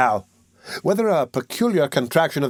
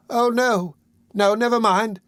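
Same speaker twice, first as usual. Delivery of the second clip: high feigned recovery; 8 semitones higher than usual. A narrator's reading. Nancy waves off the doctor.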